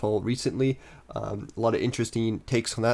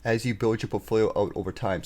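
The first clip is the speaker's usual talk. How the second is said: ordinary talk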